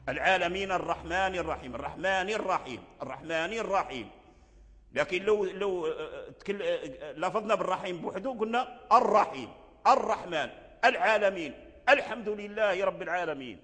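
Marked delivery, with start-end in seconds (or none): 0.1-4.1 العالمين الرحمن الرحيم joined with no glottal start between words; linked, hamza dropped. Shown as a demonstration. Teacher demonstrating linking, الرحمن الرحيم without hamza. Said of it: "فإذا أدرجنا حذفناها". 8.9-13.6 each of الرحيم، الرحمن، العالمين، الحمد opened on a firm glottal a; sounded initial hamza. Shown as a demonstration. Teacher reading words alone, الرحمن with sounded hamza. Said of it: "كلها تترجع همزة".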